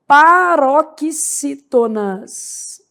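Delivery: very slow paroxítonas; drawn-out emphasis. Shown as a demonstration. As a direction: spell out paroxítonas syllable by syllable. Teacher stresses the category name.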